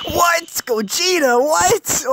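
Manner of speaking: very high astonished yelps; shocked squeal. Real, not acted. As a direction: squeak out each word in shock. Shocked at rare summon.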